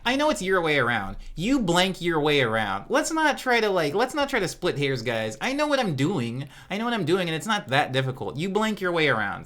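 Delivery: high, loud insisting; heated insistence. Real, not acted. Streamer insisting to chat about the lyrics, loud and high.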